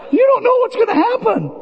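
high, fast teasing warning; mock alarm. Real, not acted. Pastor joking to the congregation about Gary, high and teasing. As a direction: tease the crowd about Gary.

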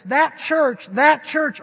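loud, high, pointing far off with that church; distant that church. Shown as a demonstration. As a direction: say that church as if it were someone else's. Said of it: "A people that don't talk like this".

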